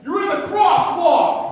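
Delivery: high, drawn-out furious shout; angry yelling. Acted. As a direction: bellow it slowly like an outraged cyclist. Acted angry cyclist yelling at driver.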